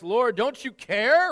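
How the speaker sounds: drawn-out complaint to the Lord; complaining. Acted. Martha complaining to Jesus, whiny and slow. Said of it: "A little complaint there".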